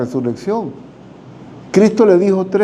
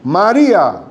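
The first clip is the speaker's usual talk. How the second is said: higher, tender call of "María"; gentle calling of her name; 5 semitones higher, slower than usual. Acted. Jesus tenderly calling María by name. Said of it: "Y Jesús le dijo".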